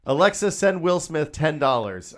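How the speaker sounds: slow, loud order to a smart speaker; mock voice command. Jokingly commands listeners' devices.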